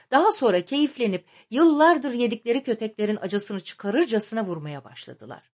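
ordinary delivery

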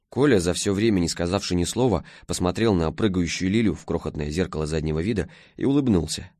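plain reading voice

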